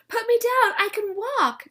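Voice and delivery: put-on high, loud protest from a little girl; child yelling to be put down; screaming child. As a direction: scream it like a kid who hates being carried. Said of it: "screamed Jane".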